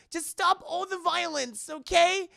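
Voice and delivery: high-pitched imitation of a pleading girl; high, acted appeal to stop; mock pleading. Voicing a girl pleading in the story.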